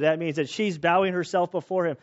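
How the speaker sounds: usual speaking voice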